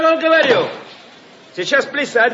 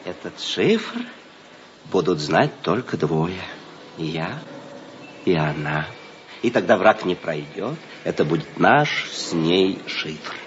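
low, secretive, mock-serious; conspiratorial low voice; 7 dB quieter than usual. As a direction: whisper it like a spy's secret. Secretive joking with Tanya. (заговорщицки тихо)

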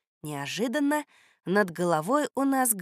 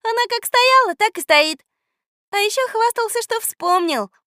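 high, loud teasing voice; mocking girl; 8 dB louder than usual. A narrator's reading. Girl teasing the boy whose spell failed, mocking. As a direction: jeer like an amused little girl. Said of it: "засмеялась Люська".

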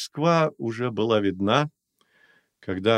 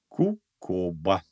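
slowly savouring the name Кукоба; slow repeat; 2 semitones lower, much slower than usual. Acted. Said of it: "повторила Любора". (медленно протянуть «Кукоба»)

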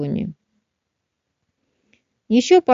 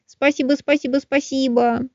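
squeaky thankful delight; excited squirrel; 7 semitones higher than usual. Acted.

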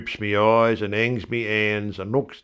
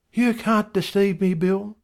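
much higher wife voice; an indignant wife, higher; wife's voice; 12 semitones higher than usual. A narrator's reading. Wife scolding husband Bill, much higher.

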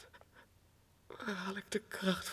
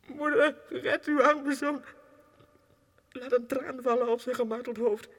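loud, high anguished plea; desperate pleading; 4 semitones higher, 7 dB louder than usual. Acted. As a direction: beg with all your strength. Pleading to his mother. (luid en smekend, vol wanhoop)

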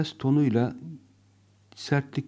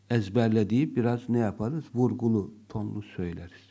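normal talking voice

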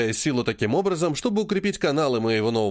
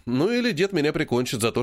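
ordinary delivery, nothing marked

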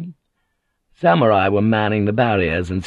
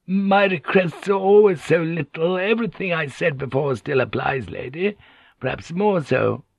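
higher, humble pleading voice of a scheming woman; polite wheedling woman; 8 semitones higher than usual. Acted. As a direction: wheedle politely, pitched up at the start.

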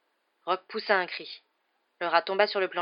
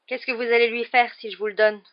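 louder, higher, worried question; tense; 5 semitones higher, 6 dB louder than usual. A narrator's reading. Ron fears for his rat before handing it over, tense. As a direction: ask warily, voice raised with tension. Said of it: "demanda Ron tendu".

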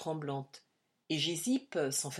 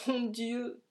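higher, crying out to God; anguished cry; 7 semitones higher than usual. A narrator's reading. Vanier alone, crying out to God in anguish. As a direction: cry out the first plea. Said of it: "Vanier s'écria".

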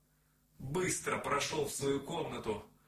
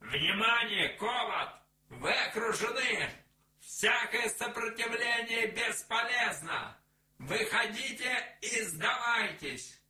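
loud, high megaphone announcement; outdoor loudspeaker; 11 semitones higher, 5 dB louder than usual. A narrator's reading. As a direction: bark orders through a loudspeaker. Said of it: "голос из громкоговорителя". (объявление через громкоговоритель)

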